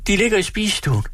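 a flustered landlady wailing at the pot; flustered hostess. Acted. Frantic hostess fussing at the stove that won't boil.

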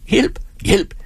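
high, panicked scream for help; terrified cry for help. Acted. Paralysed girl crying for help in terror.

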